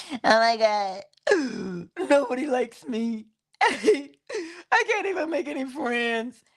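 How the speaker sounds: whiny, slow mock inner voice with a gasp and laugh; mock nobody-likes-me whine; mock self-talk. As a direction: play the anxious voice in your head, hammed up lightly. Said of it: "is that what you're saying to yourself".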